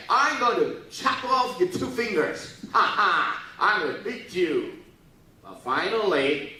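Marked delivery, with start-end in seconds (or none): 0.1-4.8 high, loud and slow mocking threat; taunting French soldier. French soldier taunting English archers, mocking threat. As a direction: jeer at the enemy archers. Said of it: "And the French say".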